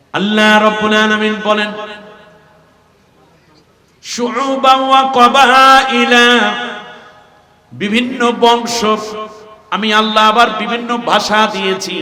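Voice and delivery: loud, high, slow preaching chant; preaching at full force. Acted. Preacher chanting to the gathering, loud and slow. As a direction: swell the verse out to the crowd.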